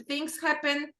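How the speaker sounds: ordinary delivery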